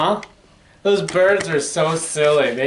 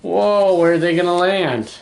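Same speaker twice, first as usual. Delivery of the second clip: usual speaking voice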